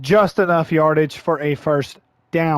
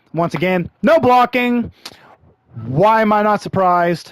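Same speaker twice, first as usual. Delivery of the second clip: exasperated outcry; 3 semitones higher than usual. Real, not acted. Gamer watching his runner get stopped, exasperated outcry. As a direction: throw up hands at the screen, voice jumping. Exasperated complaint about blocking.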